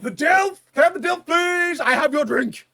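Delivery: high, booming call for a customer; barista shout. Acted. Barista summoning the Dilf to collect his drink, loud shout. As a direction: holler it proudly over the crowd. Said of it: "No, I'd be like".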